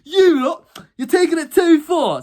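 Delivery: teacher calling the sent-out pupils to order; teacher scolding. Retold or imitated. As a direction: scold the whole group from the doorway. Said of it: "He's like".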